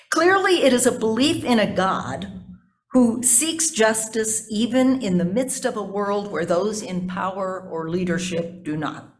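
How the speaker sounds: normal talking voice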